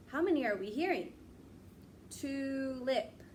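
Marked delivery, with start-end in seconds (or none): none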